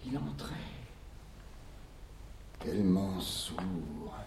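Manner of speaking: hushed. Acted. Hushed storytelling.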